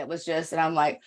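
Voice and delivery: usual speaking voice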